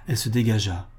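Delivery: plain reading voice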